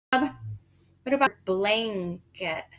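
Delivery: blanket drawn out slowly, leaning on the ng; slow sounded-out word. Shown as a demonstration. Teacher sounding out blanket for the child. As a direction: stretch blanket so the ng rings. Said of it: "We say blanket".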